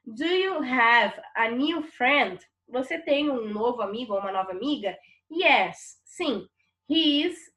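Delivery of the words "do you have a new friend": slow, deliberate English sentence; slow classroom reading of the English question; slow model reading. Shown as a demonstration. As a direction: read it slowly so students follow. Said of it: "O primeiro diálogo dizia assim".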